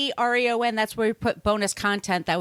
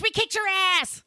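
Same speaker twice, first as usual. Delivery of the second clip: mock taunt; 10 semitones higher than usual. Mock taunt to British listeners.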